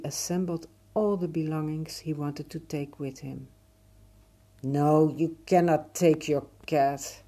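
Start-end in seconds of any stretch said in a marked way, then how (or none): 4.6-7.2 stern father. Acted. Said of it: "his father said".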